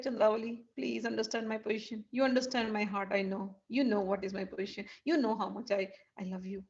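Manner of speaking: softly, gently pleading; tender pleading. Acted. Krishna to tearful Chandravali, softly pleading.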